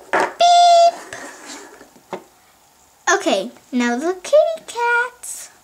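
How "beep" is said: long, loud, very high beep; scanner beep. Pretend. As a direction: mimic a scanner, holding the tone. Imitating the register scanner.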